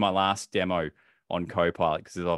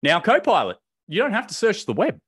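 raised voice cutting the assistant off; loud; 12 semitones higher, 7 dB louder than usual. Real, not acted. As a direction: talk over the assistant, louder. Interrupting the voice assistant.

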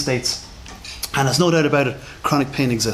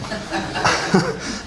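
a quick breathy laugh at his own joke; short laugh; 4 semitones higher, 3 dB quieter than usual. Real, not acted. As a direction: laugh along with the room. Audience joke about bullshitting.

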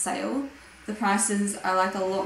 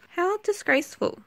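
mock outrage; 9 semitones higher than usual. Mock horror at worn pajamas.

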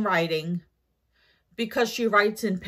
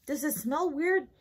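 ordinary delivery

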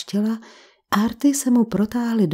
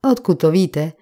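barked; 4 semitones lower than usual. A narrator's reading. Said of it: "vybafl na ní Winter".

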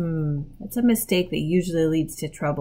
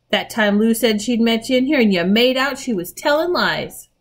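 louder, raised reproach; indignant accusation; 5 semitones higher, 6 dB louder than usual. A narrator's reading. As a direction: confront a sibling over a lie. Peter confronts Edmund over the lie.

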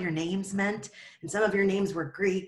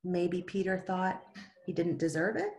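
ordinary delivery, nothing marked

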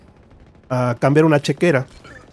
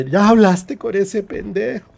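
higher, slower indignant question; raised-pitch mock outrage; retold indignant demand; 6 semitones higher, 3 dB louder than usual. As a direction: demand it, drawing the words out. Retold angry phone-side outburst.

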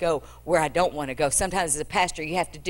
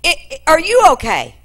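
higher, louder servant pressing her; urgent question; 10 semitones higher, 10 dB louder than usual. Acted. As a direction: call out anxiously after her.

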